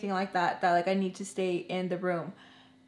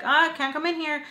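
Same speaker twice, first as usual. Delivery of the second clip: loud high call out; 8 semitones higher, 5 dB louder than usual. Retold or imitated. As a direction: shout them off through the door. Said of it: "like, super loud".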